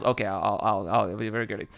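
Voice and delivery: ordinary delivery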